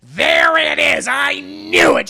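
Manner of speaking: high, drawn-out shout of vindication; triumphant shout. Retold or imitated. Retelling a character saying she knew it, exultant shout. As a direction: crow it loudly, stretching the words. Acted gloating reaction in retelling.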